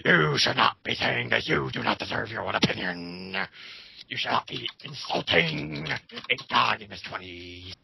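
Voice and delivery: mock the scolds with a put-on voice; mocking whiny critic.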